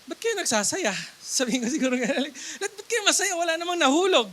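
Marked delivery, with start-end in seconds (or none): 0.0-0.9 high-pitched baffled questioning; mock puzzlement. Preacher imagines doubting the celebrating Israelites, mock puzzlement. 2.6-4.3 high, incredulous questioning; mock puzzlement. Preacher plays a doubter as nothing has fallen, mock puzzlement.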